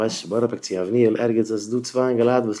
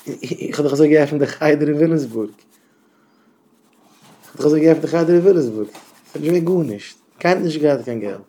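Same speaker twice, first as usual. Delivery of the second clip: agitated protest; 3 semitones higher, 6 dB louder than usual. Acted. Principal insisting he has no money, agitated. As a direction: protest loudly, stumbling into it.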